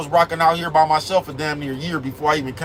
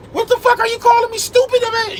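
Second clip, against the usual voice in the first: much higher, louder shrieked outburst; exploding at him; 4 dB louder than usual. Retold or imitated. Woman explodes at a simple question, shrieking in falsetto. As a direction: blow up in a shrill falsetto. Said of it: "the bitch will explode".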